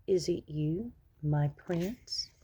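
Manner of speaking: softly whispered, just waking; soft, tender whisper. Acted. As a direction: breathe it to him as you wake. Waking princess greets her prince.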